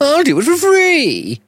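a prissy squeaky assassin; hammed-up squeaky Englishman; squeaky mock posh hitman. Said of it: "De Gaulle was tall".

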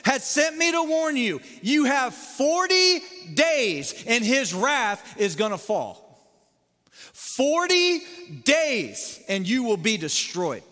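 loud, high, slow prophet's cry of doom; preacher playing Jonah, crying doom to Nineveh; Jonah's warning. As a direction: thunder it out like Jonah in the streets. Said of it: "let me just kinda slip into Jonah here".

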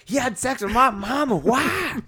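high, loud comic movie voice; Chappelle impression. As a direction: squeak it out in an over-the-top stoner voice. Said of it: "I love Chappelle".

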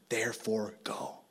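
soft unvoiced whisper. Real, not acted. Preacher whispers the story's punchline to the church. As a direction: whisper it like a secret. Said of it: "whispered punchline".

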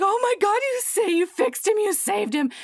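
high-pitched gushing relief, acted out; overjoyed. Woman thanks rescuer for fixing her robot, overjoyed. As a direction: gush breathlessly as the relieved heroine.

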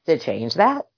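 normal talking voice

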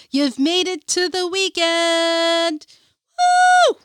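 big hyped-up cheer; hype man. Real, not acted. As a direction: pump up the viewers. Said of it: "your personal hype man".

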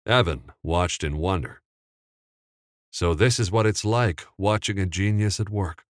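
ordinary delivery

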